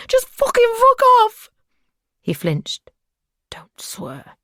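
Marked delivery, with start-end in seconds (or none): 0.1-1.5 high, loud, raw scream; terrified captive screaming in fury. A narrator's reading. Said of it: "Her voice rose to a scream". 3.5-4.4 much softer, unvoiced mutter. A narrator's reading. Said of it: "he muttered".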